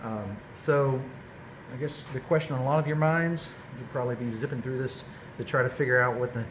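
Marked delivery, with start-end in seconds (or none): none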